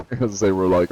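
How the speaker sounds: normal talking voice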